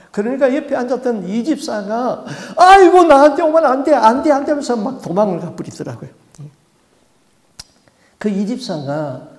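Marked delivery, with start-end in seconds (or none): 2.6-4.5 very high, loud frantic protest; the deacon shrieking no as she runs; panicked deacon. Retold or imitated. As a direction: beg it to stay away.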